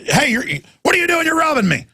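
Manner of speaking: high mock yell of a robbed homeowner; hammed-up cry of a homeowner being robbed; alarmed homeowner. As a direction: shout as if catching a thief in your house. Homeowner voiced catching burglars.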